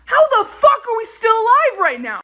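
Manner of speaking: high, incredulous outburst; incredulous exclamation. Real, not acted. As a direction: exclaim it in disbelief. Outraged fly fact.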